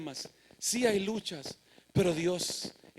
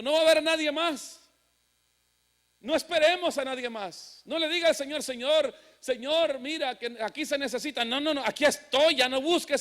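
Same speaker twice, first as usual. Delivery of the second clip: raised, insistent exhortation; urgent preaching; 5 semitones higher, 4 dB louder than usual. Real, not acted. Preacher telling congregation not to wait for others, urgent. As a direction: press the church to step up.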